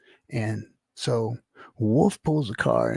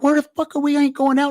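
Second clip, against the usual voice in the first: much higher, panicked protest; alarmed disbelief; 12 semitones higher, 4 dB louder, faster than usual. Retold or imitated. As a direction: squeak out a freaked-out objection. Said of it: "And I'm like".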